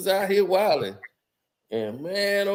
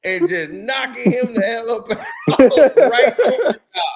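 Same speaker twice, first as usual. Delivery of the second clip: laughing mock threat; 3 semitones higher, 4 dB louder than usual. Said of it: "I'm like".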